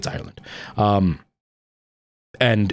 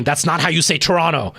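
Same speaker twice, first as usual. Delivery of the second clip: higher-pitched mock protest; indignant correction; 6 semitones higher than usual. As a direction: imitate Canadians objecting. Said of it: "all the Canadians were going".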